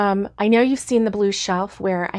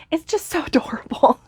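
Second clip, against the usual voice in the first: laughing through the word, pitched up high; laughing fondly; 8 semitones higher than usual. Real, not acted. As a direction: giggle as you gush over it. Gushing over baby elk colors.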